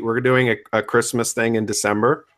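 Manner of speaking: usual speaking voice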